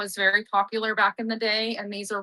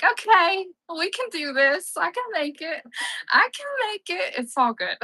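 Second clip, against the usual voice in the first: high-pitched, strained self-encouragement; mock pep talk to self; 7 semitones higher than usual. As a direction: squeak it out like you're barely holding on. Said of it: "I'm just like".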